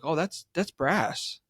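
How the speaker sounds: slow, lightly put-on sniffy voice; mock dismissive dealer. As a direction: drag it out like a bored dealer. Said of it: "and she's like".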